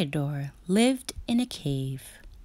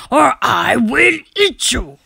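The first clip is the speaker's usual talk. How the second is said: bellowing; 8 semitones higher, 9 dB louder than usual. A narrator's reading. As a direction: boom it out to scare the boy off. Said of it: "he bellowed".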